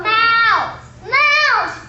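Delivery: loud high scream of Mouse!. Acted. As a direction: shriek it in panic. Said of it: "Scream it together".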